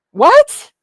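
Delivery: loud, high-pitched baffled cry; incredulous outburst. Real, not acted. Streamer reacts to rival's nonsense, loudly incredulous. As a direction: burst out in sharp disbelief.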